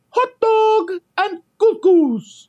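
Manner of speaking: very high, slow mock announcer voice; train announcer. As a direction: mimic the train attendant, high and drawn out. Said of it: "She would say".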